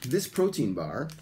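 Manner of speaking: deliberate, clearly enunciated English for repetition; slow model sentence. Shown as a demonstration. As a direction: say it slowly in separate phrases for students. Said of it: "Please listen and repeat one more time".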